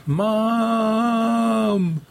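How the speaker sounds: high, long-held scream at narrator's volume. A narrator's reading. Daughter cries to her endangered mother, screamed. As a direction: stretch the call into a high wail. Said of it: "Meg screamed".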